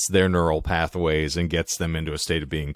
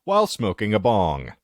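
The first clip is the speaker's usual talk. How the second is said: higher, measured headline announcing; announcer-style read, pitched up; 7 semitones higher than usual. Real, not acted. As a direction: present the odd story like a news item. Reads out a headline.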